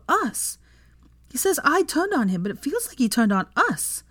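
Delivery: put-on high-pitched girl venting her hurt; hurt, angry daughter. A narrator's reading. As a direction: pour out a child's grievance, high and upset.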